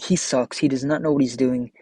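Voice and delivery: ordinary talk, nothing marked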